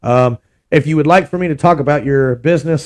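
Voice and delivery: usual speaking voice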